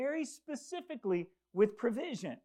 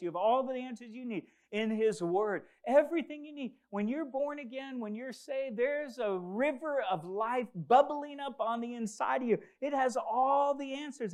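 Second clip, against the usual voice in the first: rising, louder preacher's build; preaching at full force; 4 semitones higher, 4 dB louder than usual. Real, not acted. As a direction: preach it out, lifting the room. Preaching build on living water.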